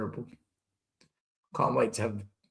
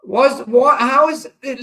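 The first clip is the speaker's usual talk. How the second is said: exasperated outburst; 8 semitones higher, 8 dB louder than usual. Real, not acted. Fan outraged at referee decision.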